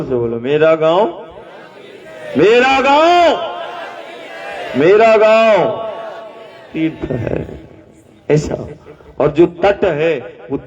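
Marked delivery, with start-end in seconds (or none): none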